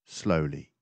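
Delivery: ordinary delivery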